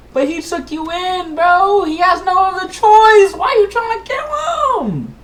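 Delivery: high, loud, crying shout; outraged yell. Acted. Player screaming at the king in outrage.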